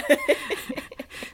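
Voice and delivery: high, bright burst of laughter. Real, not acted. Laughing at newscaster joke.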